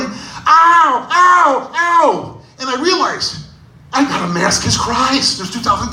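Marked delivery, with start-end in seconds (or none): 0.0-2.4 put-on high yelping ows, kid in pain; mock kid yelps. Kid squeezed by Santa yelps in high-pitched pain. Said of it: "this kid starts going".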